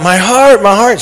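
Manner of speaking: high, strained anguished cry. Retold or imitated. Man on the ground crying to the nurse, high anguished cry. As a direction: cry it out in pain, pitch way up. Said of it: "he's crying out".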